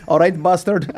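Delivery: lightly hammed-up café regular; mock local greeting. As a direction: hail a stranger with playful roughness. Acts out café men greeting.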